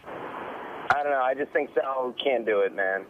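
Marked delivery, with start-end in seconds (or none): none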